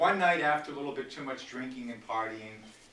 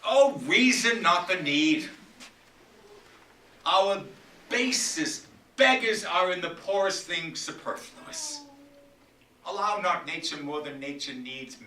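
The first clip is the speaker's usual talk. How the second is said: loud, higher, full stage declamation; impassioned Shakespeare; 5 semitones higher, 8 dB louder than usual. Acted. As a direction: rage at the daughters from the stage. Performs Lear's speech.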